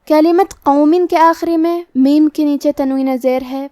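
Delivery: normal talking voice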